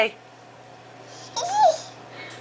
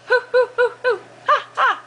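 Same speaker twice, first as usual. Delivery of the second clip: monkey noise; 9 semitones higher, faster than usual. Shown as a demonstration. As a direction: hoot like a monkey for the kids. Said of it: "What does a monkey say?".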